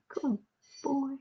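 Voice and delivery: usual speaking voice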